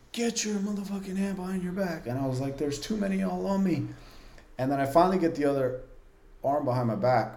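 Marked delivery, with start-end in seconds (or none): 0.1-2.1 mock shout. Officer ordering a pinned inmate, imitated shout held down in volume. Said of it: "he's shouting". 2.6-3.9 high-pitched strained complaint; mock calling out from under a pile, volume held back; retold protest. Pinned inmate answering the officers on top, high retold protest.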